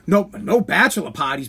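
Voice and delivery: normal talking voice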